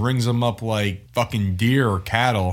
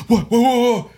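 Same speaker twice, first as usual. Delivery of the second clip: startled; 12 semitones higher, 5 dB louder than usual. Retold or imitated. Startled awake in retold story.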